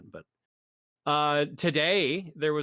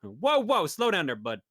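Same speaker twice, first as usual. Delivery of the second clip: put-on New York accent; 5 semitones higher, 3 dB louder than usual. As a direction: throw on a mock New York accent for the catchphrase. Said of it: "Accent included".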